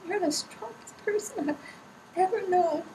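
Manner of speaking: high, tearful, choked-up voice; crying. Real, not acted. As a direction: let real tears push the voice up high. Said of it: "I'm not afraid to cry".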